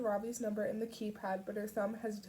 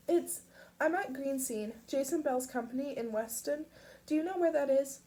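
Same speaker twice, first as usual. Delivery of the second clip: urgent; 5 semitones higher, 4 dB louder than usual. A narrator's reading. Pip urgent.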